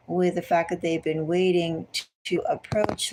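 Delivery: normal talking voice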